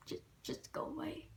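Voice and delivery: very soft, voiceless grumble; retold exasperation, barely voiced; fed-up dismissal. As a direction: wave them off under your breath. Retold fed-up exit from the boss.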